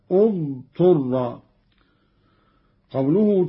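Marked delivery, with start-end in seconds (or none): 0.1-1.4 اضطر started on u, "uḍṭurra"; initial damma. Shown as a demonstration. Teacher showing how to start afresh, اضطر opened with damma. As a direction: begin اضطر slowly with a short u on the first hamza. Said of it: "والبدء بضم همزة الوصل".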